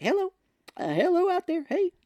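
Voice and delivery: very high, plaintive hail; mock calling out. Locked-out man trying to get back into meeting, mock calling. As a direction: imitate someone calling through a closed door.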